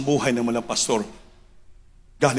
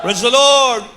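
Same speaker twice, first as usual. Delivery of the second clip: higher, louder praise shout; rousing call; 8 semitones higher, 6 dB louder, slower than usual. Real, not acted. Preacher leading the congregation in praise, rousing call. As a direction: call out praise with a lift on LORD.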